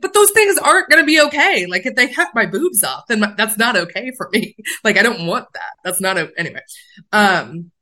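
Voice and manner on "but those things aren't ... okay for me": upset protest. Retold or imitated. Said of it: "and I'm like".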